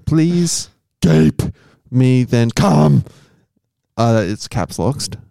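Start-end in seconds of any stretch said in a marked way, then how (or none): 0.1-3.0 slow, deliberate hammed-up plea; drawn-out mock-earnest performance, punching the last word; in-character title reading. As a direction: act it out with big pauses between phrases. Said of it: "the tone you think they, they meant it".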